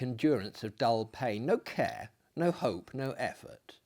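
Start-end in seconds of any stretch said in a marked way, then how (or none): none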